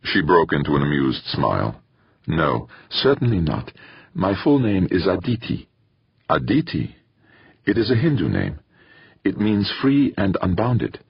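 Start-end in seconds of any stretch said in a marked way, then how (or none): 2.3-8.6 lightly voiced young woman with an Indian lilt; a hint of an Indian accent, lifted higher; gentle Indian lilt. A narrator's reading. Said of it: "That explains the lilt in her accent". 9.2-11.0 slow, higher "free and unbounded" with a light Indian lilt. A narrator's reading. Addie explains her name's meaning, slow Indian lilt. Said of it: "the lilt in her accent".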